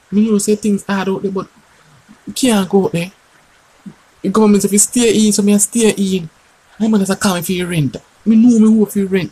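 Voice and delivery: comic high-voiced neighbour; high character voice. High put-on voice, many lines.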